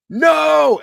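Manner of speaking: very high, loud retold scream. Stopped high above the ground, screaming in terror. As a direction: shriek it as the coaster drops.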